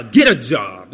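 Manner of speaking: short, loud brush-off, retold; hammed-up dismissive yell; mock shout. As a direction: bark it at someone begging. Snaps at a panhandler in story.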